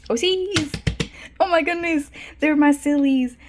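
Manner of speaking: high, loud, delighted squeal; gleeful high outburst; excited squeal. Real, not acted. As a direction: squeal over beloved characters. Excited at finding her characters.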